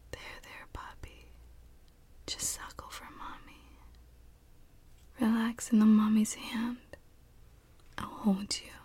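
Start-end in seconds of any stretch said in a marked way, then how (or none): none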